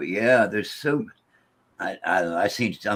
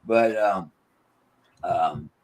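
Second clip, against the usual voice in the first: usual speaking voice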